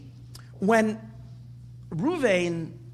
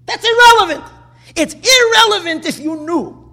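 much higher and louder, cut the excuse off hard; emphatic rebuttal; 9 semitones higher, 10 dB louder than usual. Real, not acted. Preacher to his listeners, rejecting the excuse with a loud, high rebuttal. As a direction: slam the excuse down as irrelevant.

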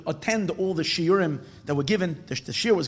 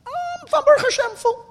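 very high, odd simple man's voice; simple man's answer; 5 dB louder than usual. Acted. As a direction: answer naively, pitched way up. Simple attendant answers the Rebbe.

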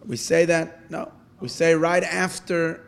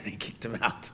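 ordinary talk

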